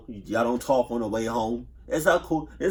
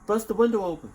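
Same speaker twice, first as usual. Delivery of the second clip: excited urging; 8 semitones higher, faster than usual. Real, not acted. Urging reaction.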